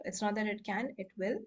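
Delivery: ordinary talk, nothing marked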